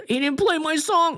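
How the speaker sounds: high, mocking whine; exaggerated high-pitched grievance; whiny complaining guest. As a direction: mimic a petty complainer in a high voice. Mimics guest's bad review.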